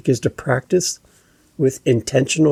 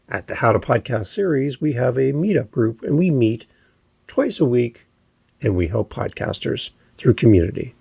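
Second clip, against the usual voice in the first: definitive statement, stepping down to "community"; down inflection. Shown as a demonstration. Podcast coach describing the meetup group, falling inflection at the end. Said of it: "All of them went down in my inflection".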